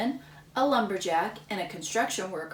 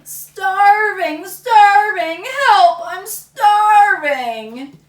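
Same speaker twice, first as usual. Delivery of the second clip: very loud, high wailing cry of hunger; yelling; 10 semitones higher, 15 dB louder, much slower than usual. Acted. Samuel rolling in the grass yelling for food, loud wail. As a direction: wail it dramatically.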